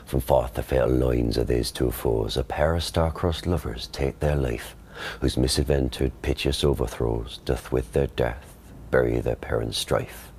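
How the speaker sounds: Shakespearean English accent, rhotic R; quiet Elizabethan original pronunciation reading. Shown as a demonstration. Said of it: "You do that one?".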